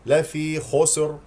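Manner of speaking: slow "لفي خسر" ending in khusor; mistaken voweled س. Shown as a demonstration. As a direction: add a wrong vowel after س in "خسر". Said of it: "وهذا خطأ لأنه حرك السين". (نطق "خسر" خطأً بسين متحركة)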